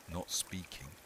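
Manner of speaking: ordinary delivery, nothing marked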